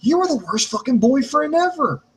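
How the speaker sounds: high-pitched mock telling-off; exasperated scolding. Fan to a wrestler character who keeps trucking along, exasperated scolding.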